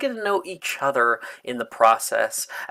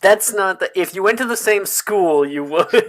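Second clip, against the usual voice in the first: flustered; 6 semitones higher, 6 dB louder than usual. Real, not acted. Flustered panic about backstory.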